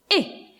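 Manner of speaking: I as an isolated short "ih"; short i sound. Shown as a demonstration. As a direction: sound out I as in the start of ink. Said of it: "lowercase I says".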